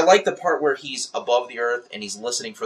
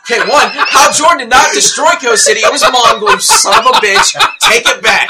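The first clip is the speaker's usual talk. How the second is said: loud, high indignant rant; mock outrage; 11 semitones higher, 13 dB louder than usual. Real, not acted. Fan defending Hal Jordan after being baited, mock outrage. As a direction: yell it in playful fan fury.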